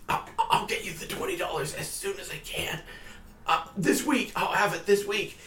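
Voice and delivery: high, soft, nervous stammer; frightened plea. Acted. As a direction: beg for time in a small, scared voice. Frightened debtor begging for time.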